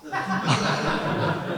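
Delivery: breathy burst of real laughter. Real, not acted. Laughing at the guy who works for Comcast.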